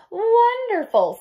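very high, loud, slow drawn-out; Amy praising her long sleep; blissful Amy. Acted. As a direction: savour the word dreamily.

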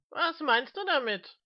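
high-voiced, swooping question; challenging companion. A narrator's reading. Mister Smith challenging the old man, high voice.

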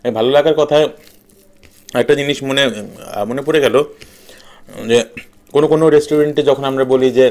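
talking with food in mouth; words muffled by chewing; chewing while talking. Real, not acted. Seen 3: Mouth moves in chewing shapes while eating by hand throughout.

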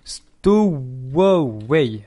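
very slow, each syllable stretched; drawn-out title. Real, not acted. Streamer telling viewers which game is on, drawn out.

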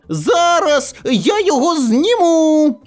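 put-on high, slow child's voice; eager boy. A narrator's reading. Boy in glasses offers to climb the fence, eager. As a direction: boast in a high little-boy voice.

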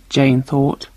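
usual speaking voice

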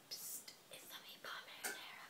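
secret stage whisper. Pretend. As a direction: lean in and whisper it. Stage whisper revealing the answer.

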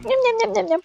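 high, rapid yum-yum babble; playful high-pitched munching noises; nom-nom eating noise. Pretend. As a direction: squeal little nyam-nyams as if gobbling. Imitates greedy eating noises.

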